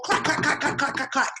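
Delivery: high, quick mimicked heel clacks; clacking heels. Running to fetch the ring, she mimics her heels clacking, high and quick.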